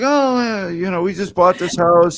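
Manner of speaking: high, chirpy new neighbour voice; mock new homeowner. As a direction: play the oblivious happy homeowner. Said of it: "Can you imagine being like".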